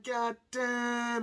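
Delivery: loud, high stunned exclamation; amazed. Retold or imitated. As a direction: gasp it out in shock. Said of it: "I'm like".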